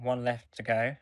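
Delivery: normal talking voice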